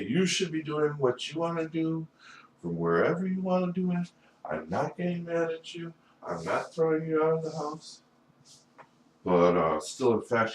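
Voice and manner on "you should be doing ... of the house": high, put-on reassurance; high-pitched imagined fatherly speech; acting an ideal dad. Voices what dad should say.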